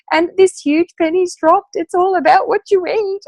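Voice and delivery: high and loud, choked with happy tears; tearful realisation. Retold or imitated. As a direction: cry it out in a burst of relief. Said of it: "I started crying and kept crying".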